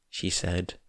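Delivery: ordinary delivery, nothing marked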